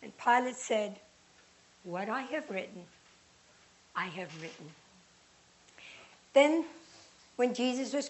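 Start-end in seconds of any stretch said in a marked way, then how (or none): 1.8-4.7 low, soft and final; firm refusal. Acted. Pilate refusing the chief priests, low quiet finality. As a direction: settle it quietly and firmly.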